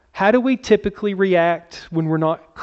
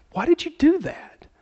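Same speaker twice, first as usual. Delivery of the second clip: much higher, incredulous; mock exasperated; 11 semitones higher, 4 dB quieter than usual. Preacher scolding a squirrel that ran in front of him, high exasperation. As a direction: throw up your hands at the silly squirrel. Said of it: "You're like".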